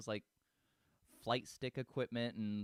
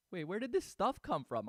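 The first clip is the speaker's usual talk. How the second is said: a higher, louder imitation of a puzzled husband; husband's puzzled question; 9 semitones higher, 5 dB louder than usual. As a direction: retell the husband's baffled question, pitched up. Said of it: "my husband's looking at me like".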